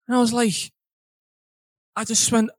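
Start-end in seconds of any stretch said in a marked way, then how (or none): none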